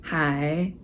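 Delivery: usual speaking voice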